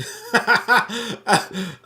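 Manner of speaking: loud, high burst of laughter; laughing. Real, not acted. Host realises he misread the sum, laughing out loud. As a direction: burst out laughing at your own mistake.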